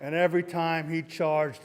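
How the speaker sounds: usual speaking voice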